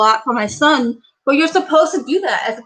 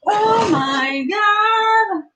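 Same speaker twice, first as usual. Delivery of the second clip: high, drawn-out, almost sung cry of delight; thrilled; 8 semitones higher, much slower than usual. Real, not acted. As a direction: stretch every word out in high-pitched amazement. Thrilled on opening the present.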